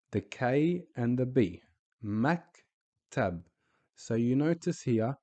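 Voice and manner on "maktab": "Maktab" cut into mak, tab with a stop after the K. Shown as a demonstration. Teacher showing learners the two sukoons, stopped consonants. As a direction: halt on the K and the final B in "Maktab", no vowel after. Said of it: "we stop on the K sound".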